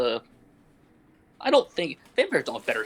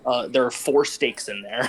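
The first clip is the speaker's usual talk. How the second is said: ordinary talk, nothing marked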